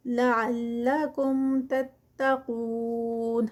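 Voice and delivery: تتقون with the long u held four counts at the pause; four-count stretch at the stop. Shown as a demonstration. Teacher showing the stop stretch on تتقون. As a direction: hold the و of تتقون for four beats. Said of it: "four counts کا stretch تتقون میں".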